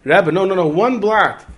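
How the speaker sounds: a student frustrated he isn't heard; exasperated protest. Retold or imitated. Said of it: "He goes".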